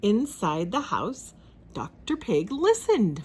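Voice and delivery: ordinary delivery, nothing marked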